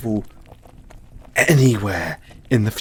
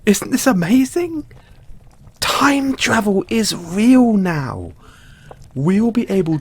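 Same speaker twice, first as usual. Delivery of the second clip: much higher and louder, bursting with excitement; nearly shouting with joy; thrilled; 8 semitones higher, 4 dB louder than usual. Acted. Ecstatic over working time machine.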